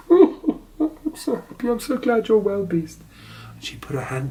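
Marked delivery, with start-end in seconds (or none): 0.1-3.0 much higher, slow sobbing joy; crying happily. A narrator's reading. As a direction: cry out happy tears. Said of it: "she started crying like this".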